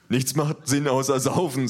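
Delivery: slow, dull brute voice; dopey big thug. A narrator's reading. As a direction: drawl it like a dimwit. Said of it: "sagt der Große". (langsamer, dümmlicher Schläger)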